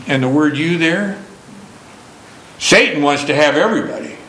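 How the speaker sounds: normal talking voice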